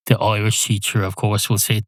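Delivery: normal talking voice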